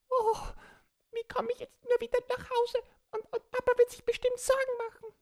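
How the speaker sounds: high, fast, anxious little voice; worried child voice. Acted. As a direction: fret quickly like a lost child. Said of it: "Borki war ganz verzweifelt".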